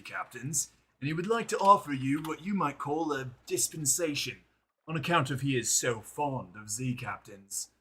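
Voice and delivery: courtly envoy. Acted. Streamer voicing the mentor's envoy to the captain, hammed-up courtly voice. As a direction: play the smooth envoy flattering the captain. Reads NPC envoy dialogue.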